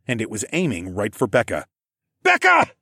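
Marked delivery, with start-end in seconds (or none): 2.2-2.7 high, loud panicked scream. A narrator's reading. Narrator screams to warn Becca of the lunging dino, screaming. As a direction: scream the name in alarm. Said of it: "I screamed".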